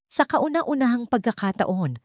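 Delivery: ordinary delivery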